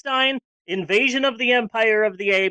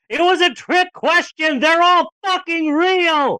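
high, loud gleeful reveal; spring the surprise with glee; excited reveal; 9 semitones higher, 4 dB louder than usual. Real, not acted. Reveals the trick question.

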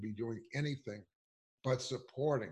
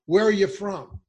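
high, loud sneering question; mock reporter; 6 semitones higher, 10 dB louder than usual. Reporter grilling the young man about his origin, high and loud mockery. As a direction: ask it like a suspicious reporter. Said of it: "this bastard has the nerve to say".

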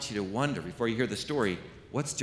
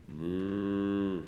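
cow moo; 2 semitones lower than usual. Pretend. Cow sound for children's story.

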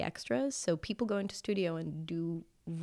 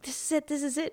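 higher, eager whisper-free anticipation; giddy as your scene starts; excited; 9 semitones higher than usual. Retold or imitated. Watching his film debut with parents, excitedly higher. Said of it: "I'm like".

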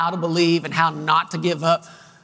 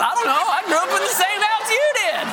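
much higher, indignant little-sister voice; sister's comeback; 12 semitones higher than usual. Retold or imitated. Sister retorting to her brother about their upbringing, high indignant voice. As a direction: toss it back high like a cornered younger sister.

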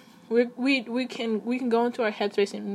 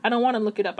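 usual speaking voice

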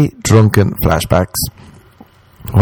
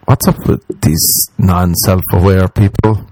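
ordinary talk

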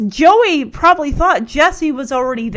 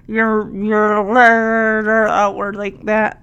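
mouth pulled wide; 4 semitones lower than usual. Shown as a demonstration. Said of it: "fingers in your mouth and pull".

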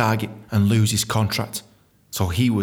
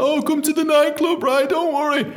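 high-pitched imitation of a receptionist; Amanda. Receptionist leaves a message after the firing, high mock reassurance. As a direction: put on a high, reassuring girl's voice. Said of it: "this girl called Amanda".

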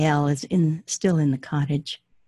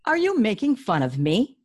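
higher, offended question; indignant prince; 6 semitones higher than usual. Acted. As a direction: sound offended.

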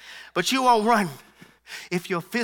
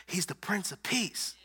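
quiet realization; 10 dB quieter, slower than usual. Retold or imitated. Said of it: "Until I begin to think".

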